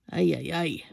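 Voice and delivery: lightly attempted Spanish accent; terrible Spanish accent. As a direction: sigh it out in a bad Spanish accent. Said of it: "That was a terrible accent".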